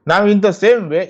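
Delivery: usual speaking voice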